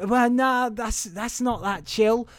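high, slow Southern drawl; Southern accent. Acted. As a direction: object in a put-on Southern accent. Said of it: "where's my Southern accent?".

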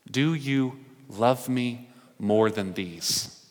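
slow, soft asking; slow searching question. Retold or imitated. Jesus asking Peter, slow and searching. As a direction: weigh each word.

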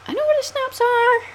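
"are" lifted up high like a question; "snaps are" ends on a high upward tune; rising end. Real, not acted. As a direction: let "are," climb at the end. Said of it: "I'm going up at the end of my sentence".

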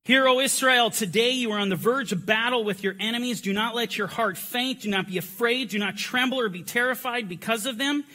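loud, high rallying proclamation; preacher rousing the room, loud and high; battle cry reading. Real, not acted. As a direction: call the congregation to battle. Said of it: "We are gonna do our battle cry".

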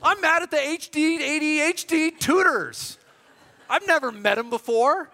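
high, loud, slowed mock rage; playful outrage at the tutors; comic anger. Real, not acted. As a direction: rant it like a wronged man. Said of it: "'cause I'm so mad".

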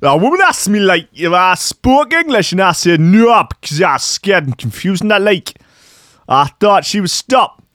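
voicing the commuter in a South American accent. Host reads the commuter's statement in a South American accent. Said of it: "I'll do the accent".

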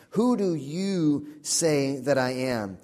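slow, deliberate, with the weight on "you"; stress on you. Shown as a demonstration. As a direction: ask it slowly, leaning on "you". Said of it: "emphasizing the personal nature of this question".

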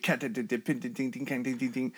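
soft mouthed cymbal dings; drum sound imitation. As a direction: tap out the beat with your voice. Imitating the ride cymbal beat.

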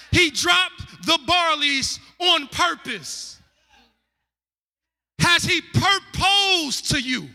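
drawn out, every word weighted; slow it right down and let it land; slow emphatic point. Real, not acted. Preacher pressing the point to the women, slow and emphatic. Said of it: "emphatic preaching point".

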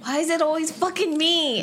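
high exasperated complaint. Real, not acted. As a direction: whine it to the room. Complaining about getting vodka.

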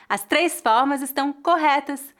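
normal talking voice

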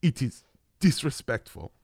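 put-on deliberate French accent with a German tinge; botched French accent. Frenchman scolding a tourist near the arches, botched French accent. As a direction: imitate a stern Frenchman, halting then emphatic. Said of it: "more German right now than French".

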